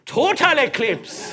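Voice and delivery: put-on slow, drawn-out Jamaican accent on Total eclipse. Advert teacher bites the whole cake, Jamaican accent. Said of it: "why she's Jamaican at the end".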